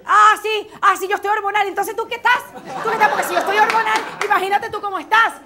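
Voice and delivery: high, loud sarcastic comeback; angry retort. Acted. Partner fights back, loud and sarcastic. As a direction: throw the accusation right back at him.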